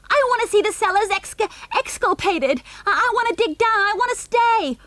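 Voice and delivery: put-on high, loud child's wail; howling. A narrator's reading. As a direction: howl like a spoilt little boy. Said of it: "howled Junior".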